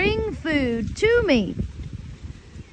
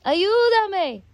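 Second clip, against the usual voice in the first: higher mock shout of Ayúdame; retold call for help; 7 semitones higher than usual. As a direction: call out to the neighbors in Spanish, pitched up. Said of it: "I would scream to the neighbors".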